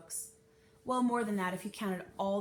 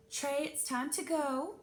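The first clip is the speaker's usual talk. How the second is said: calling; 7 semitones higher, 3 dB louder, slower than usual. Acted. Mother calling her son.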